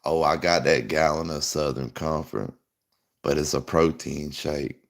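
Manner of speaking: low, soft creepy come-on; creepy stranger. Retold or imitated. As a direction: murmur it low like a sleazy lure. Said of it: "He probably did have a gallon of".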